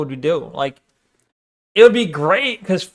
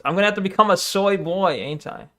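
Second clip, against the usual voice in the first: ordinary talk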